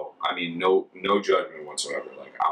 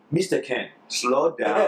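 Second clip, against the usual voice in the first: high-pitched imitation of a Ghanaian villager; Ghanaian villager accent; 9 semitones higher, 3 dB louder, slower than usual. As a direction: put on a Ghanaian accent, much higher, pleading. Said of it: "they'd be like".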